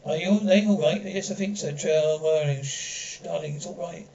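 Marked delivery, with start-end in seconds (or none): 2.6-4.1 whispered shush, then soft reassurance; hushed comfort. Acted. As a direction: hush the baby gently back to calm. Hushing a crying baby.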